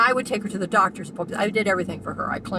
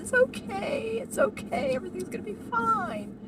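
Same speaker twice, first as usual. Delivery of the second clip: much higher, gentle comforting; soothing reassurance. Retold or imitated. Comforting the anxious old lady in the waiting room, gentle and high. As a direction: soothe her like a scared child. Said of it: "and I said".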